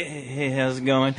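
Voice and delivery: soft, slow, low hello; sheepish, half-laughed casual hello; awkward greeting. Retold or imitated. Awkward greeting to staring manager.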